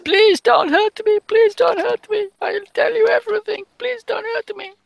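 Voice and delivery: squeaky put-on voice of a tearful French girl; pleading French girl. Game master voices a sobbing French girl begging her captors, high pleading falsetto. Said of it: "sobbings of a young girl. A French girl".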